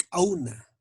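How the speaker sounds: "auna" opened with a sharp popped k; ejective K. Shown as a demonstration. Teacher giving an Aymara K apostrophe example, explosive K. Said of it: "explosión".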